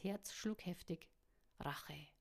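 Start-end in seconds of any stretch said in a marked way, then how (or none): 1.6-2.1 low, hushed whisper of an inner voice. A narrator's reading. Her inner voice urging revenge, low whisper. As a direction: whisper it from inside her head, lower and softer. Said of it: "rief ihre innere Stimme".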